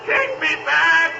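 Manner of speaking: desperate pleading. Acted. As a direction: cry out to be released, panicking. Scrooge frantically begs the ghost.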